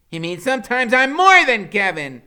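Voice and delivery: high, loud proud declaration; fierce. A narrator's reading. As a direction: proclaim it like a knight. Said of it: "still acting really fierce".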